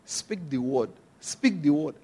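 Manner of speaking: hushed imitation of a spirit's prompting; voice of the Holy Spirit. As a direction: quote a gentle, low instruction. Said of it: "the Holy Spirit began to say".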